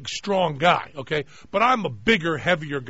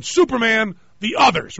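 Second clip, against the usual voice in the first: a touch higher and louder, mock heroic delivery of the line; hammed-up line reading; 6 semitones higher, 5 dB louder than usual. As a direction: say it like a big dramatic movie line, lightly overdone. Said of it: "which I delivered exactly like".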